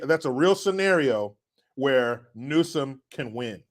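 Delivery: ordinary talk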